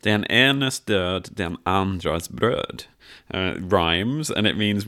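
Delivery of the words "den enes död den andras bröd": slow Swedish phrase. Shown as a demonstration. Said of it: "this week we have".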